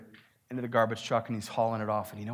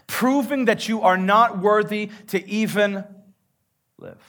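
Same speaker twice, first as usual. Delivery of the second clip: preaching rises higher and louder to a climax; building sermon; 7 semitones higher, 9 dB louder than usual. Acted. Pastor warning his church, climbing into forceful preaching.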